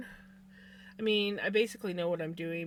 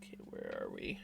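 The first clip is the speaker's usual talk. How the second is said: whisper; 12 dB quieter than usual. Real, not acted. Muttering to herself over pattern.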